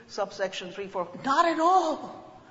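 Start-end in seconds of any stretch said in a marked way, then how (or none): 1.2-2.4 emphatic. Real, not acted. Emphatic denial to audience.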